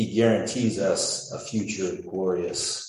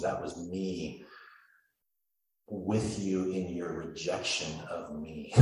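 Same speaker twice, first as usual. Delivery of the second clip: slow emphatic; 5 dB quieter, slower than usual. Acted.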